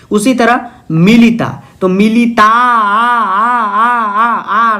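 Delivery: stretching आ long and high to show the ending; drawn-out aa sound. Shown as a demonstration. Teacher stretching the आ ending for students. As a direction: sing out the आ so students hear it. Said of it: "अंत में आ रहा है".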